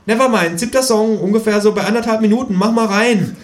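high, loud and bossy; kangaroo voice. A narrator's reading. Kangaroo telling the narrator to play the song, high and loud. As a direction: order it up eagerly.

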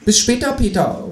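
high kangaroo call. A narrator's reading. Kangaroo leaving through the door, high parting call. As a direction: call back cheekily in a high voice. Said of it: "ruft das Teutelbier".

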